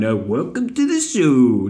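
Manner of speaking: slow odd ironic "welcome to the show"; drawn-out mock-cheesy sign-off; weird ironic sign-off. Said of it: "saying it in a, a weird way".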